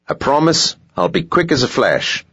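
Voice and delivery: high, wheedling South African promise; pushy clerk sweetening his insistence; South African accent. Acted. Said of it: "South African accent".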